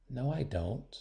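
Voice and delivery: soft, barely-there words; unheard reply. A narrator's reading. Willow answering to herself after being ignored, hushed. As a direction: say it so no one hears. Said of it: "No one heard her reply".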